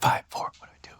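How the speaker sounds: hushed countdown whisper. Retold or imitated. Whispering to dad during the countdown, hushed. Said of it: "they're like".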